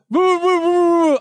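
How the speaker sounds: mumbling through a mask; mock muffled mask talk. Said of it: "I can't understand you".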